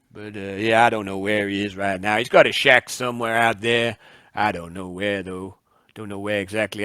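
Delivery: a lightly voiced barkeep answering a stranger; barman. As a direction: chat like a helpful bar owner. GM plays the barman.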